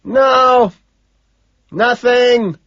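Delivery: high, loud yells split by a pause; full-volume holler; screaming. A narrator's reading. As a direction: scream it till the throat is raw. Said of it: "I scream".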